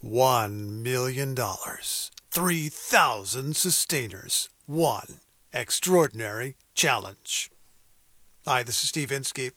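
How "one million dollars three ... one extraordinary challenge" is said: slow, deep trailer voice; booming movie trailer read; movie announcer voice. Shown as a demonstration. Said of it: "in movie announcer voice".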